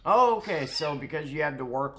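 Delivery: high, loud mocking oh okay; loud high sarcastic okay; sarcastic yelp. Real, not acted. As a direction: fake surprise dripping sarcasm. Sarcastic outburst at neighbor.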